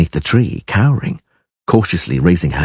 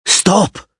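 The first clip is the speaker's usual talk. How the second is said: sharp higher shout; 11 semitones higher than usual. A narrator's reading. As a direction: call her to stop. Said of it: "shouted Geralt".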